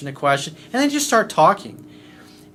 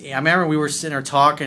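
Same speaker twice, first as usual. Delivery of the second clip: normal talking voice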